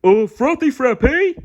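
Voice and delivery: loud, high, dopey starfish voice; Patrick voice. A narrator's reading. Patrick guessing at SpongeBob's news, loud dopey voice. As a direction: ask eagerly and slow. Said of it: "Patrick asked".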